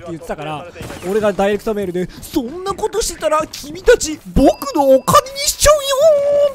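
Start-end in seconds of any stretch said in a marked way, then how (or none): 2.2-6.5 much higher, slow sing-song teasing; gloating taunt. Retold or imitated. Answering his friend's complaint by message, gloating sing-song taunt. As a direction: drag it out like a smug villain. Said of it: "ってね、チャットしておきましたね".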